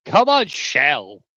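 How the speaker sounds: sharp, high call of come on; loud urging. Real, not acted. Streamer to chat, loud urging come on.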